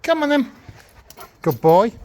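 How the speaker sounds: very high coaxing call to the dog. Real, not acted. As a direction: pitch right up to bring him. Calling then praising dog.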